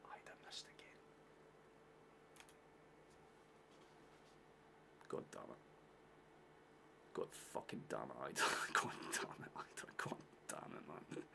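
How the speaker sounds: real breath-only cursing under the breath; hushed, voiceless exasperation after a miss; despairing whisper. Real, not acted. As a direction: curse to yourself in a defeated whisper. Despairing after missing the chance.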